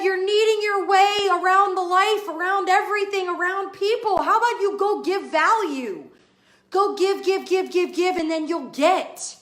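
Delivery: quoting Ray. Retold or imitated. Retelling her mentor's advice to viewers, fired up. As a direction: hammer home the give give give. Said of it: "Ray said this again".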